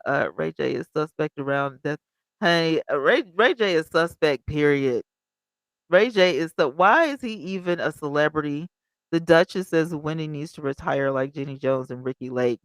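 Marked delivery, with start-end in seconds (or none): none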